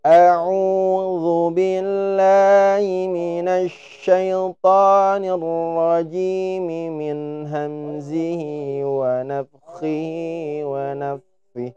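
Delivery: slow melodic recitation of أعوذ بالله من الشيطان الرجيم; recited longer ta'awudz. Shown as a demonstration. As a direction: chant الرجيم with long drawn vowels. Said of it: "Atau yang agak panjang lagi". (bacaan ta'awudz panjang, lambat dan berlagu)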